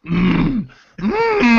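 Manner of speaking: high-pitched closed-mouth whine; wordless character hum. Acted. Player as Aurora looks at companion, high wordless hum. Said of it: "Aurora just looks at him like".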